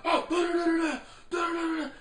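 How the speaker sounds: high, loud mock rant yelled out; a hammed-up loud street rant. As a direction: rant at the top of your voice. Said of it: "You got the people".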